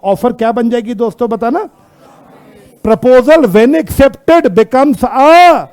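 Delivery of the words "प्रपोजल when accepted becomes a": very slow, stretched-out words; emphatic word-by-word recital; slow prompt. Shown as a demonstration. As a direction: draw out the last word for the class to finish. Prompting class to complete the definition.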